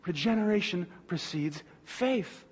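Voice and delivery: normal talking voice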